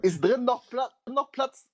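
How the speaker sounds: high, naive questioning voice; mock guest. Guest in the queue asking naively about space inside. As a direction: ask it like a clueless queuer.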